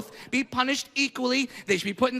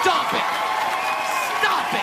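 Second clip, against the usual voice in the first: yelled stop it; 8 semitones higher than usual. Real, not acted. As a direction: yell it at the crowd. Ranting at coddling parents.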